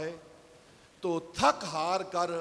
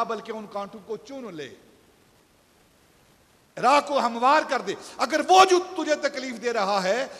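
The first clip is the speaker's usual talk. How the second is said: higher and louder, swelling sermon voice; fervent preaching; 3 semitones higher, 9 dB louder than usual. Acted. Preacher voicing the tree's counsel to listeners, fervent and loud. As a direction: preach it out with growing fire. Preacher urges forgiveness at full force.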